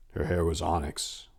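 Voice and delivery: plain reading voice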